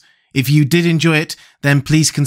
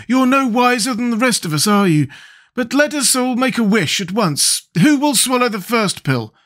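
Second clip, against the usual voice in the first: high-pitched character voice challenging the group; rally the party in a high voice; Scarecrow, brisk; 11 semitones higher than usual. Acted.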